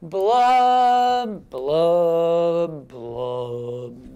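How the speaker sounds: long blubs stepping down in pitch; gloomy fish blubs. Acted. Teacher leads children in the blub part, descending blubs.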